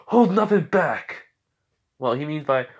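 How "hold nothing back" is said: loud, high, slow heroic shout of the order; acted full-voiced battle cry; battle command. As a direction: cry it out, urging an all-out attack.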